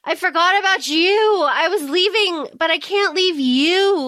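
put-on drunk Connor. Connor stumbling in to apologize to Kel, mock drunk. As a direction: play a sloppy drunk apologizing. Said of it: "Connor's drunk off his ass".

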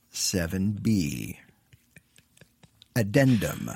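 slow, low captain's log; starship captain. Acted. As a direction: log it like a grave commander. Plays starship captain in skit.

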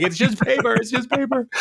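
high, panicky squeal, imitated; mock freak-out. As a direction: plead in a put-on terrified high voice. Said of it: "he's, like, freaking out".

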